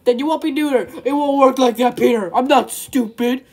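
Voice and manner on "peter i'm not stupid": usual speaking voice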